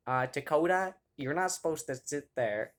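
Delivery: hammed-up old teacher scolding; mock teacher. Imitating the algebra teacher scolding a student, hammed-up.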